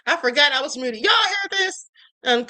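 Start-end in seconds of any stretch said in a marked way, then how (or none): 1.0-1.9 calling out. Real, not acted. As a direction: shout it out to the audience. Calling out to viewers.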